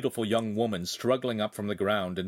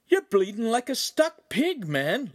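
high-pitched shocked exclamation; cried out; 13 semitones higher, 4 dB louder than usual. A narrator's reading. Friend seeing the bleeding man, high alarmed cry. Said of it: "Lincoln cried".

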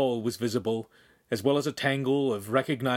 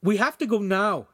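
urgent; 9 semitones higher, 5 dB louder than usual. A narrator's reading. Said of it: "Handsome said".

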